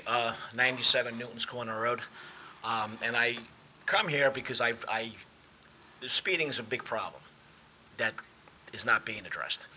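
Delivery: usual speaking voice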